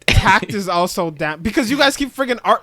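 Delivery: annoyed outburst. Real, not acted. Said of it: "Oh, shut up".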